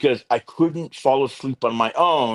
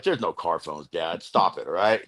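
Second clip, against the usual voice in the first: low, soft brush-off to Dad; retold under-the-breath dismissal, much lower; dismissive aside; 8 semitones lower, 4 dB quieter than usual. Dismissing his dad's car phone claim.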